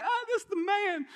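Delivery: high-pitched mock fanboy thrill; admiring excitement. John the Baptist pointing out Jesus, excitedly admiring. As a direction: gush in hammed-up admiration. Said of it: "John the Baptist is like".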